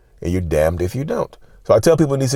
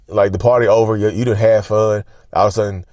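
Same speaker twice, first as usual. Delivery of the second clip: ordinary delivery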